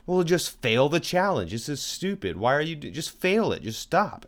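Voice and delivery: retold exasperation, pitch jumping up; exasperated wife. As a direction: urge him to quit, mock exasperation. Quotes exasperated wife arguing back.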